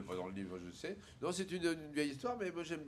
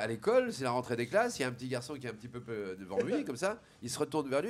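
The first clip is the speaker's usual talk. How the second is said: ordinary talk, nothing marked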